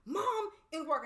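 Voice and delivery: imitating the stranger screaming at the gas station pump; retold scream. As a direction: belt it out like a breaking mom. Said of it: "she just starts screaming".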